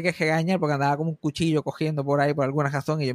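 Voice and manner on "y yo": usual speaking voice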